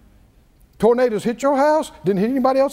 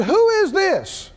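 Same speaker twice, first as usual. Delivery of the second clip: higher, slow, booming challenge; God's voice; 8 semitones higher, slower than usual. Acted. Preacher voicing God challenging Job, higher and slow. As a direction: speak as God from the whirlwind. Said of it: "God spoke out of it and said".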